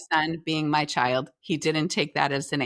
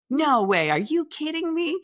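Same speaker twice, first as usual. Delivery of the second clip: disbelief; 9 semitones higher than usual. Retold or imitated. Mom hears project is done.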